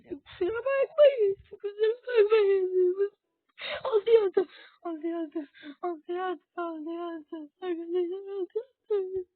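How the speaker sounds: high-pitched tearful wail; loud crying that sags into whimpering repeats; sobbing. Real, not acted. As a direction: repeat it helplessly through sobs. Crying while apologising to viewers.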